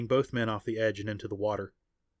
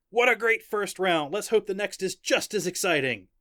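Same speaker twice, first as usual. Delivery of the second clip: excited announcer; 9 semitones higher, 4 dB louder than usual. A narrator's reading. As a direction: hype the next round to the crowd. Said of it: "the announcer said".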